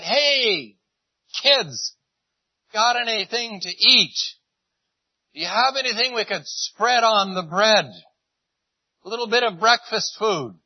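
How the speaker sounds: calling out across water. Retold or imitated. Jesus calls from the shore to the fishermen, loud friendly hail. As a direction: shout it across a hundred yards like a friendly call.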